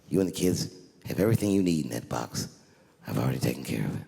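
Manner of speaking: hammed-up sincere comfort; mock tender husband. Husband soothing wife, tender.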